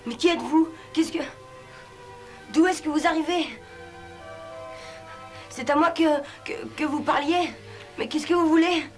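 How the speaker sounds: high, startled and stammering. Acted. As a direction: jump out of your skin, stammering. Boy startled by the genie.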